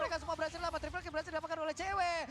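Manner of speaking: loud, rising play-by-play hype; fighting game announcer. Acted. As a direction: call the teamfight at full force. Caster hyped as fight climaxes.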